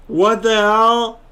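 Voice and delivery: loud, slow, outraged what the hell; shocked yell. Real, not acted. Player yelling at the game after a terrible lie, shocked shout. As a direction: belt it out in disbelief. Sudden outrage at a bad shot.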